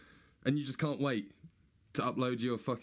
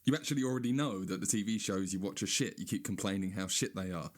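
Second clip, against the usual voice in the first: ordinary talk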